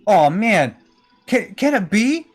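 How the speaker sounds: high-pitched, stammering disbelief; flabbergasted. Acted. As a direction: gasp it out in awed amazement. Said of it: "looks, like, just flabbergasted".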